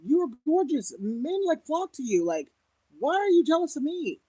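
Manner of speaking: high, loud exasperated plea; incredulous, pleading high voice; pleading to sister. Retold or imitated. To her jealous sister, exasperated high plea. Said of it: "it's like".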